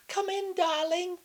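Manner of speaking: put-on very high, frail old granny; frail little voice. A narrator's reading. Grandma in bed inviting visitor in, frail high voice. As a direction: quaver it like a fragile grandma. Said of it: "in a frail little voice".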